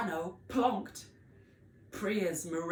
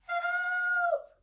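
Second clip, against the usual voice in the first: very high, small squeaky cry; tiny cry for help; 8 dB louder than usual. Acted. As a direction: call out tiny and scared. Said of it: "she cried".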